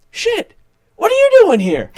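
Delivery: high, loud startled exclamation; surprised fan. Retold or imitated. Security guard startled to see him, high and loud. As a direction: blurt it out in shock. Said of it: "he goes".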